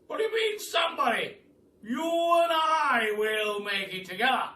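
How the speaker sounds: put-on loud, high, booming old duke; rally the boy with big excitement; the Duke shouting his grand plan to Billy; excited Duke. Duke excitedly promises the shop.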